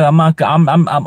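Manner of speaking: ordinary talk, nothing marked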